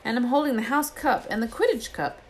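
put-on a boy dazzled by his own reflection; Ron's voice. A narrator's reading. Narrator voices Ron.

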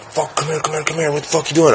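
high, hushed, hurried beckoning; urgent summons. Retold or imitated. Friend in doorway to smoker, urgent high summons.

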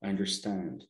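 soft, calm, accepting; calm spiritual voice. Retold or imitated. Spiritual side gently answers the ego, softly calm. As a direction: quietly soothe the upset ego. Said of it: "your spiritual side says".

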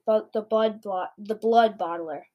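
plain reading voice

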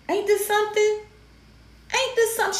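high disbelieving exclamation; incredulous. Real, not acted. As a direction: say it in shocked disbelief. Shocked by mother's choice.

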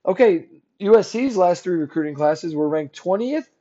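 ordinary talk, nothing marked

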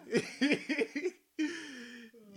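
high loud burst of laughter. Real, not acted. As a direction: crack up laughing. Laughing at own story.

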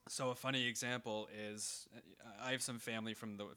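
ordinary talk

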